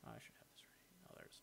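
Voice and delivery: soft whisper to himself while searching; real whisper under his breath; whispered aside. Real, not acted. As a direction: whisper it as if no one can hear.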